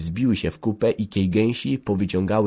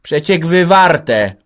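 loud, slow, annoyed reply; gruff retort; 9 semitones higher, 10 dB louder, much slower than usual. A narrator's reading. Antek answering the officer's order, grumpy retort. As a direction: snap back with drawn-out words. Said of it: "odburknął Antek".

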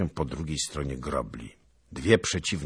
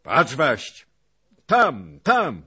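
high, urgent battlefield shout; excited yelled alert; 12 semitones higher, 11 dB louder than usual. A narrator's reading. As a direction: call a friend to look, loudly. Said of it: "i krzyknął na Zaglobę".

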